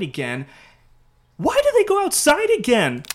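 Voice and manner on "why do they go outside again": much higher and louder, baffled; incredulous question. Real, not acted. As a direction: ask it like the characters are being ridiculous. Narrator asks, exasperated at characters.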